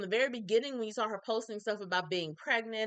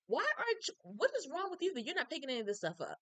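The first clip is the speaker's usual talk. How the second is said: exasperated; 7 semitones higher than usual. Real, not acted. Exasperated at Wade.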